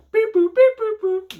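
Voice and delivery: very high, loud imitated cash machine beeps. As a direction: beep like an ATM.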